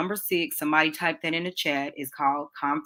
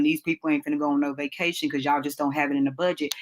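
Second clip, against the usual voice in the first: ordinary talk, nothing marked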